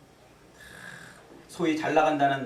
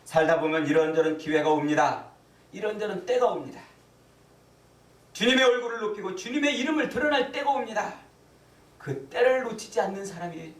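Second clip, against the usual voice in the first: raised proclamation climbing in pitch; fervent preaching; 6 semitones higher, 4 dB louder than usual. Real, not acted. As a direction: press each clause on the congregation. Preaching at full force. (회중에게 점점 높여 힘있게 선포)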